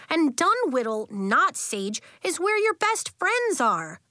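high, insistent schoolkid voice; defiant argument. A narrator's reading. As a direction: argue the case with conviction. Nori argues for her school.

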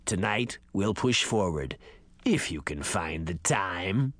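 low, creaky ancient man. Acted. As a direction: creak it out like a parched old man. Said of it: "a creaky voice said".

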